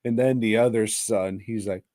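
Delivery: ordinary talk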